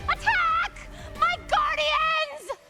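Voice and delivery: high, drawn-out battle cry; villainous command. Acted. Spurned fan summoning guardians, shrieked command. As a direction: call out the order high and slow, full drama.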